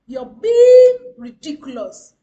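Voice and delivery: loud, slow, scornful accusation; mock angry outburst. Shown as a demonstration. Coach acting out a rude reply, mock angry shout. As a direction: blast the insult out slowly as a bad example. Quoting a rude remark to avoid.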